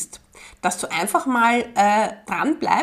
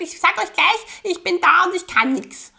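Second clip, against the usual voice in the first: newborn shouting at parents; 7 semitones higher than usual. Pretend.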